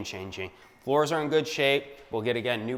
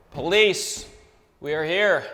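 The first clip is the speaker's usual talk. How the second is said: hyped entrance; 6 semitones higher, 7 dB louder, slower than usual. Real, not acted. Bursting into the cleared-out room.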